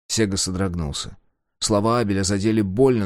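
usual speaking voice

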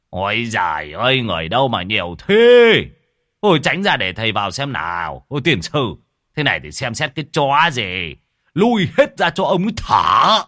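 a grumpy shaman scolding the crowd of relatives; cursing at the crowd. Acted. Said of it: "cất tiếng chửi". (chửi bới om sòm, càng lúc càng to và cao)

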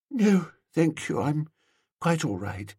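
understated tearfulness, trailing away; tearful dreamy woman. A narrator's reading. Woman declining a handkerchief, mildly tearful. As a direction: decline softly, lightly choked up.

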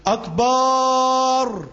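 "أكبر" with the final alif held very long; stretched alif in akbar. Shown as a demonstration. Teacher shows students the wrongly stretched alif of akbar. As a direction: drag the last vowel of "أكبر" out slowly. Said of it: "بيمد الألف".

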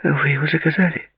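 high-pitched plaintive question; sad. A narrator's reading. Girl learning her friends booked a table, sad. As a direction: ask plaintively, pitched well up. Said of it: "грустно сказала Ширли".

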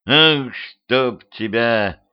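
slow, strained groan of a curse. Acted. Petrovich catches blistered vampire girl, groaning. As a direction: drag the words out in a groan. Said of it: "простонал Петрович".

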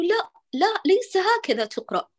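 a mock no from the mind; inner voice correcting. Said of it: "عقلك اللاواعي يعطيك إشارة".

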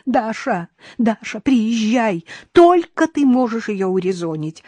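high-pitched begging; pleading. A narrator's reading. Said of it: "умоляла свекровь". (умоляюще)

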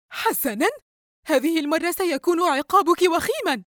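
high-pitched angry threat. A narrator's reading. Mother threatening daughter with severe punishment, very angry. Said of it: "الأم بغضب عارم".

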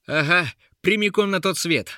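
high, defiant cry; Uril shouting. A narrator's reading. Guard answers the jeering trolls, high defiant shout. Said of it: "закричал Урил".

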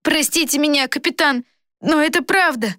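put-on high, pleading boy's voice; apologetic boy. A narrator's reading. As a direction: insist respectfully in a high boyish voice. Boy apologising to captain in story. (высокий извиняющийся голос мальчишки)

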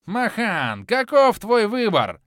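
high, loud, slow yell; shouting. A narrator's reading. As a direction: shout the demand at him. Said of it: "продолжил кричать Кармадонт".

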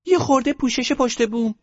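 put-on extremely high, loud girl's squeak; piercingly high put-on daughter voice; little daughter. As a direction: ask Mom in a tiny squeaky girl voice. Daughter answers mother.

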